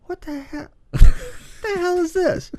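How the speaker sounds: ordinary talk, nothing marked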